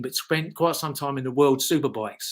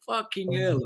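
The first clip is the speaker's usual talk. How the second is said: imitated Dutch accent, pitched up; higher, put-on Dutch accent; 5 semitones higher than usual. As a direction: mimic a Dutchman's amazed exclamation. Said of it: "in this Dutch accent".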